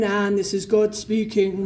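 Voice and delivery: slow, put-on voice of God; mock booming God. Telling the audience what it wasn't, mock booming God. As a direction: announce it like a grand divine visitation, in jest. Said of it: "it weren't no big booming".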